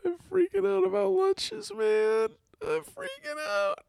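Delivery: squeaky, very high falsetto whine; mock panic. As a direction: wail it in a tiny falsetto, hammed up. Mock panic over lunches.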